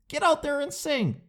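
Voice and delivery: high-pitched heckler's command; heckling bystander. Acted. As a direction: call it out high, egging on the singer. Acted bystander heckling an Idol winner.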